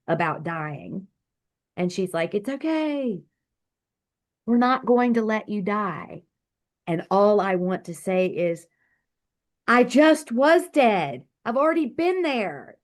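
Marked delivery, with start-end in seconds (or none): 2.3-6.2 a touch of a soothing, higher nurse voice; mock reassuring nurse. Nurse soothing her in the ambulance. 9.7-12.8 insistent retort. Retold or imitated. Insisting to the nurse she had died.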